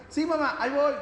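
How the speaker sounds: put-on high, dismissive kid voice; lazy child reply. Son brushes off his mother, high lazy kid voice. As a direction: brush off mom like a child who won't come. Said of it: "el hijo te escucha".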